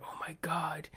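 hushed, whispered amazement; awed whisper. Retold or imitated. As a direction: whisper it under your breath, awestruck. Retold awed reaction in theater.

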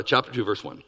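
usual speaking voice

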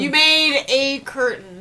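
slow, with "a" drawn out as "ay"; "a" held apart as a long "ay"; stress on A. Acted. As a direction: concede it slowly, leaning on "a". Echoes the correction, stressing the article.

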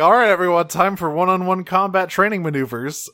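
mock commander. Megatron announces combat training to everyone, mock commanding. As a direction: call the troops to drills, hammed-up.

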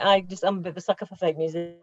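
usual speaking voice

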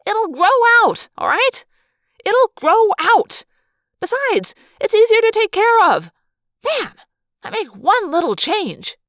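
high, loud, touchy protest; defensive yelling. A narrator's reading. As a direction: shout back at the giggling friends. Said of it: "I yelled".

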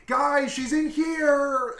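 high, loud, drawn-out mock yell; calling out. Alex alerting the family after she attacks, calling out. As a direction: holler it slowly to the whole house. Husband betrays her, calls his family.

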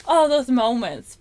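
ordinary talk

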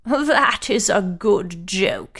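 slow, scornful hare voice; mocking hare. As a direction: scoff at it slowly. Hare mocks the tortoise.

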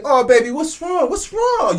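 put-on high, cooing baby talk; cooing mother to baby. Mother to crying baby, cooing high. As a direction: coo at the crying baby like a doting mom. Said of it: "y'all say".

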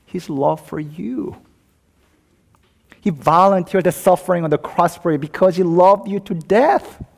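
urgent, high-pitched pulpit appeal; impassioned preaching. Real, not acted. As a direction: build to a loud, ringing declaration. Preaches at full force.

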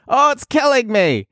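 high, mock English accent; chirpy put-on English accent, lightly done. Shown as a demonstration. Host shows off British slang in a high mock English accent. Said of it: "Is that your, uh, English accent?".

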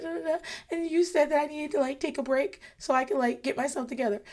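put-on high, childish rambling confession. Mother voicing her child explaining why she's in timeout, high childish voice.

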